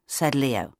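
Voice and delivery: ordinary delivery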